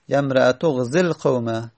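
"غزی القوم" linked as ghzel-qawma; joined ending. Shown as a demonstration. Teacher completes the phrase, "یمرأتو غزی القوم" joined. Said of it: "اغزی القوم هست".

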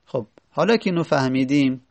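usual speaking voice

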